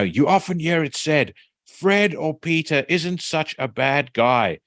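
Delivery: high, slow preachy teacher voice; preacher teacher quote. Retold or imitated. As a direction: lecture the kids like a preacher. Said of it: "he looks up and he says".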